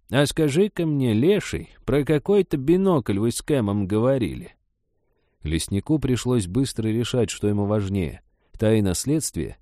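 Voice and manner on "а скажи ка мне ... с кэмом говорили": put-on high-pitched nosy old woman; pointed, higher-voiced grilling; old woman, probing. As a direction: question him like a suspicious granny. Old woman questioning the forester.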